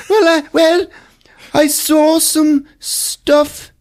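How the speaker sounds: very high, slow, breathy-prim lady voice; prim English lady. Retold or imitated. Eve telling Hugh what she saw, hammed-up high prim lady. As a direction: play a flustered posh lady. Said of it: "She's like".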